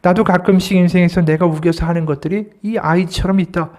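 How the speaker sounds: ordinary talk, nothing marked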